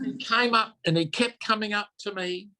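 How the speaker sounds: ordinary talk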